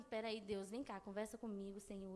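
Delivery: soft, intimate call to God; calm prayer. Retold or imitated. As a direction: pause and talk to the Lord gently. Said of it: "eu não parei pra falar assim".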